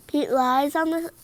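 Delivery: usual speaking voice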